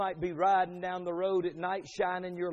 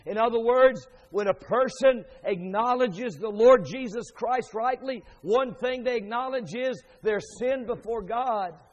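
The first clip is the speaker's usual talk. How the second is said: raised, loud, urgent proclamation; forceful preaching; 7 semitones higher, 9 dB louder than usual. Real, not acted. Preacher tells congregation to come confessing sin, forceful preaching. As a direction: press the call to confession with mounting force.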